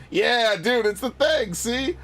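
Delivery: high-pitched excited exclamation; thrilled. Real, not acted. Player showing viewers the thing he loves, thrilled. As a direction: burst out in real delight.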